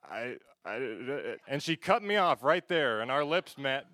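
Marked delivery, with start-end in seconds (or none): 0.0-1.4 mock seductive stammer; a hammed-up attempt at sounding sexy; sensual voice.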